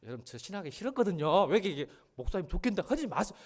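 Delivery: usual speaking voice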